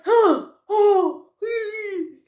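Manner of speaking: high gasped "oh, oh", then a squeal; acted yelps ending in a thin whine; cold-shock gasps. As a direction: react to ice-cold cloth on skin. Acts out icy wet pants.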